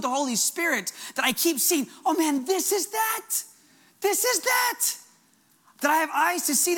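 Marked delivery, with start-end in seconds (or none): 2.1-4.8 high, drawn-out exclamation, climbing higher on the repeat; excited recognition. Retold or imitated. Preacher spotting the kingdom everywhere he goes, excited recognition. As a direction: marvel aloud, pitch leaping up.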